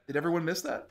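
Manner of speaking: normal talking voice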